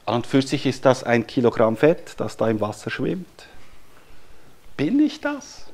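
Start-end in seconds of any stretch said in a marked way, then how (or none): 4.8-5.7 much higher, odd-toned Bin ich das; rhetorical wonder. Acted. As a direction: ask it in wide-eyed wonder. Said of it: "Wer ist das?". (staunend hoch gefragt)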